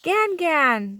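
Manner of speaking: high, loud happy call; shout. A narrator's reading. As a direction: call grandma from the car. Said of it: "they shouted".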